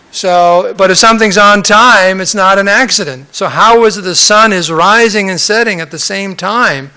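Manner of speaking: ordinary talk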